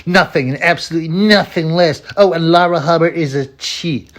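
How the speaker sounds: high-pitched mocking profile read; hammed-up indignant read-out; mock indignant woman.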